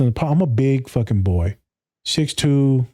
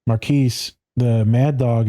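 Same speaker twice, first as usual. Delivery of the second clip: ordinary talk, nothing marked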